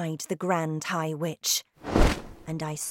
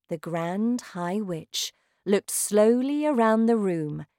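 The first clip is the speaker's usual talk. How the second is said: usual speaking voice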